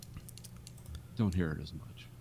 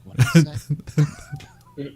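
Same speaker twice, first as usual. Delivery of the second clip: higher, louder, very slow baffled question; baffled Londoners; 8 semitones higher, 9 dB louder, much slower than usual. Retold or imitated. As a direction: drag it out like you can't understand a word. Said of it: "even the guys from London are like".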